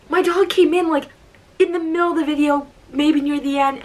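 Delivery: high-pitched, excited mock astonishment; amazed disbelief. Fan amazed he saw her dog in the video, disbelief.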